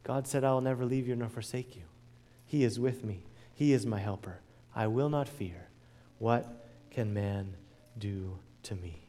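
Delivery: almost breathe the promise to them; hushed recitation. Real, not acted.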